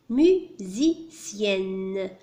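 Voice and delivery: "musicienne" very slowly, mu, si, cienne; syllable by syllable. Shown as a demonstration. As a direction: break "musicienne" into three separate syllables. Teacher splits word into syllables.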